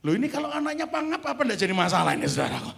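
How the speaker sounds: high, loud and fast, building outrage; fervent preaching. Real, not acted. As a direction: rouse the congregation with mock alarm. Fervent preaching, retelling David.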